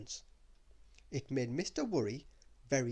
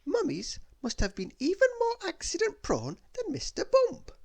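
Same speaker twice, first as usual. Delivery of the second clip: put-on much higher, bouncy girl voice; Little Miss Somersault; 6 dB louder than usual. Little Miss Somersault marvelling at the mummy, high bouncy voice. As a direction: pipe it up like an eager little girl.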